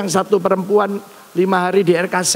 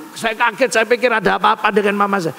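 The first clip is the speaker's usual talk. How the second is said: normal talking voice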